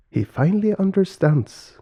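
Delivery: high-pitched motherly character voice; relieved mother. Acted. Mother finally understood by father, high relieved voice.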